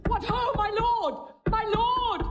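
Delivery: calling out. Acted. Emilia calling at the door.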